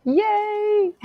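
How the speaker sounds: high, loud joyful yay into a laugh; excited cheer. Real, not acted. Coach cheering her listener on, squealing yay and laughing. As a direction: cheer like a friend just won.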